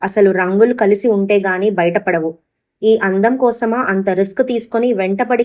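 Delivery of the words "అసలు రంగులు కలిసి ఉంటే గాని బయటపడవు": plain reading voice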